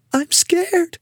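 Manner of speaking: small, high, frightened voice; high-pitched, timid fear; scared whisper. A narrator's reading. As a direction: whimper it high and hushed. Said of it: "whispered Marie".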